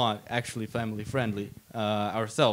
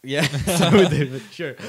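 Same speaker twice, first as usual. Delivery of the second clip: laughing; 2 semitones higher, 8 dB louder than usual. Real, not acted. Laughing while agreeing.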